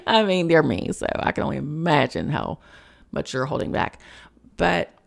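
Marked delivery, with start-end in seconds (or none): none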